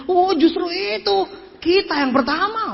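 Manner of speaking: high, stretched-out eager answer; imitated enthusiastic comeback. Speaker answering who got a car, high drawn-out enthusiasm. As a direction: draw it out high with mock enthusiasm. Acted salesman answering a sceptical question.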